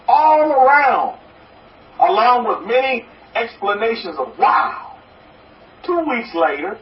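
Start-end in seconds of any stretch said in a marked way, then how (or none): none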